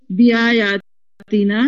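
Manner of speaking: بیایاتنا drawn out slowly syllable by syllable; slow syllable drill. Shown as a demonstration. Teacher leading the class, drawing بیایاتنا out slowly. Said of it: "جی بولیے".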